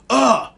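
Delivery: loud, high groan of rage; frustrated groan. Retold or imitated. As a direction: let the anger out in a groan. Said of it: "I'm like".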